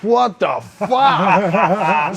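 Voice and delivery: stunned at the news that he's in; stunned disbelief. Retold or imitated. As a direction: stretch it out in total disbelief. Told he got in.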